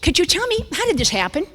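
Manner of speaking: high-pitched imitation of a nurse; nurse asking. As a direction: put on a high questioning nurse voice. Nurse questions her in retold scene.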